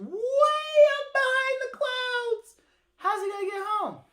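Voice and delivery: high, loud, storybook alarm; dramatic storytelling. A narrator's reading. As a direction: build the suspense of being stranded. Narrator dramatizes tortoise's plight.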